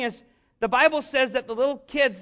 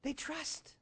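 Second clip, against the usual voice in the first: barely voiced "They trust."; soft whisper; 2 semitones higher, 18 dB quieter than usual. Real, not acted. Pastor speaking of his son, hushed almost to a whisper. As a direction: whisper it like a secret. Quiet closing point on children.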